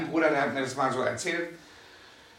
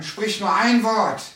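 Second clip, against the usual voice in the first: higher, louder, slow plea; centurion's plea; 6 semitones higher, 6 dB louder, slower than usual. Retold or imitated. Preacher voicing the centurion asking Jesus, a loud slow plea. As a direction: beg for just one word. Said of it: "sagt der Hauptmann".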